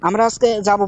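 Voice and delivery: ordinary talk, nothing marked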